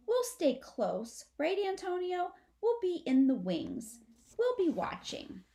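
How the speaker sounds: lightly voiced possum brother; possum Amlet reassuring. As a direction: comfort the little sister gently. Amlet reassures little sister.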